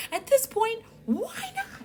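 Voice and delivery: a mock unhinged squeal of despair; unhinged squeak. Real, not acted. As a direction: sound like you've lost your mind. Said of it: "the kings have cost me my last tattered shreds of sanity".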